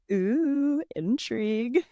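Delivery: hostess savoring a juicy plot twist; playful intrigue. Pretend. Podcast host reacting to a romance novel's blurb, playfully intrigued.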